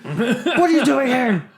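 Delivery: high, drawn-out shocked question, laughing; startled exclamation. Retold or imitated. Retelling the scare from friend Chelsea, startled. Acting out his startled reaction.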